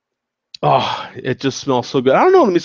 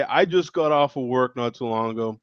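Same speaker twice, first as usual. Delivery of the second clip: ordinary delivery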